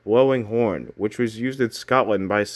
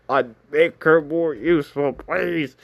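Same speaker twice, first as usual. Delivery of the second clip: high, loud mock plea; begging; 6 semitones higher, 3 dB louder, slower than usual. Cookie fan pleading for her to be made useful, begging. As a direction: plead with exaggerated desperation, pitched up. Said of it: "I'm begging".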